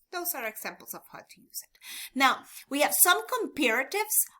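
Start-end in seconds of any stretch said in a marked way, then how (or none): 0.1-1.8 unvoiced aside whisper; soft whispered aside. Real, not acted. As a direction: whisper it to yourself. Teacher aside while typing.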